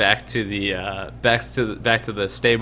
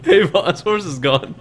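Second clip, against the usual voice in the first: talking horse voice; 7 semitones higher, 3 dB louder, slower than usual. Shown as a demonstration. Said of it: "so that's what you hear".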